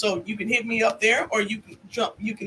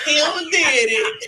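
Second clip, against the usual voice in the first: put-on slow, laughing baby talk; 4 semitones higher, 5 dB louder, much slower than usual. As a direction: drag it out while laughing.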